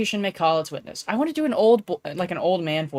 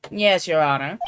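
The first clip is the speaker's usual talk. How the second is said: normal talking voice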